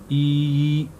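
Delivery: drawn-out long ee sound. Shown as a demonstration. Teacher drawing out ee for students. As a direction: stretch the ee out slowly. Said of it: "थोड़ा सा लंबा बोलना है".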